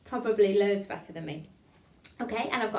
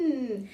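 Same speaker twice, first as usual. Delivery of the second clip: hummed siren; 3 semitones higher, 4 dB louder than usual. Shown as a demonstration. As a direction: hum sweeping from lowest to high. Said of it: "go as low as you can".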